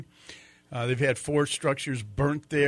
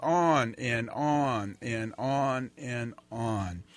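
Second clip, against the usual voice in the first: slow, repetitive "on and on"; dragging, evenly repeated words; wearily drawn out; much slower than usual. Real, not acted. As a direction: stretch each "on" to sound endless. Stresses how endlessly the fires continue.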